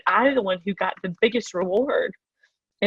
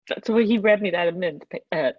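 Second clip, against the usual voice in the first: ordinary talk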